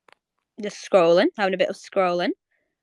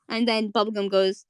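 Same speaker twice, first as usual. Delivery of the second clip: ordinary talk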